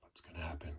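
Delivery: hushed suspense whisper. Acted. Narrator wondering what the bird will do, a hushed whisper. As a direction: whisper as if afraid to spoil it. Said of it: "whisper heard".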